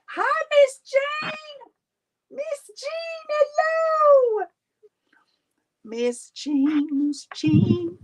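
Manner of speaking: very high, drawn-out hello; delighted greeting. Real, not acted. Host greets Miss Jean as she joins, delighted greeting. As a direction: call out in a high, stretched squeal.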